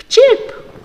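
put-on playful chirp for kids; bird chirp.

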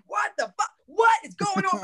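mock exasperated sideline rant; frustrated cursing. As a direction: curse at the players from the sideline. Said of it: "and I'm cursing".

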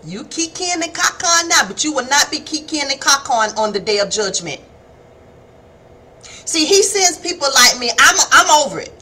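ordinary delivery

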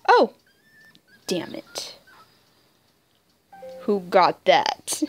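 ordinary talk, nothing marked

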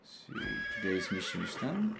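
ordinary talk, nothing marked

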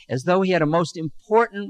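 ordinary delivery

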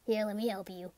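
ordinary talk

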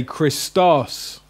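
Christos with a long, stressed final -OS; slow kris-TOS, weight on the end of Christos; stress on last syllable. Shown as a demonstration. Teacher modelling the accent, Christos stressed on the last syllable. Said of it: "the accent is on the omicron".

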